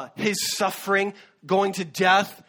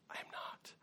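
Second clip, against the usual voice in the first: barely audible whisper; hushed, voiceless acted denial; 23 dB quieter than usual. Voicing Peter's shamed denial.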